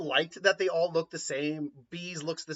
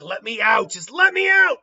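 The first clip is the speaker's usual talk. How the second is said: demon roar; 6 semitones higher, 11 dB louder than usual. Acted. Shukaku demanding release.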